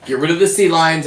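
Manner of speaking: usual speaking voice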